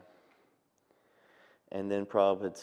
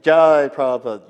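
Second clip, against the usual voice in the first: much louder, ringing call of praise; joyful call; 5 semitones higher, 14 dB louder than usual. Retold or imitated. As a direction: shout it out in glorification. Devotees respond in glorification.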